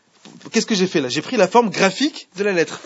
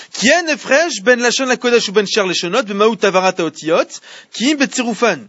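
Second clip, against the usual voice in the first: text recital; 4 semitones higher, 3 dB louder than usual. Acted. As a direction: intone the source text like a study-hall reading. Reads Hebrew source text aloud.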